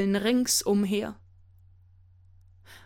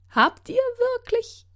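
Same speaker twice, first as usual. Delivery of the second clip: put-on old beggar woman voice; 8 semitones higher than usual. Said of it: "fragte die Bettlerin".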